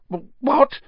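sudden high startled gasp of a question; groggy startled stammer, pitched high; startled awake. Acted. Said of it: "said Hermione, waking with a start".

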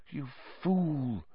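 soft, quiet rebuke; softly. A narrator's reading. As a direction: say it softly, sadly. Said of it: "said Lupin softly".

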